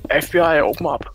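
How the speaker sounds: grave federal agent. Pretend. Joke raid call at door.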